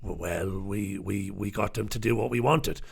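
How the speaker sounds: low, soft, halting mock confession full of uhs; old whip impression. Retelling the former Chief Whip's BBC admission, low halting mock voice. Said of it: "What did you do, Tim?".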